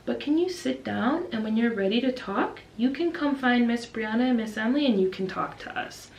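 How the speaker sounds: slow, careful preschool-teacher coaxing; calm teacher. Retold or imitated. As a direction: talk a small child down gently and patiently. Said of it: "me and my co-teacher are just so calm".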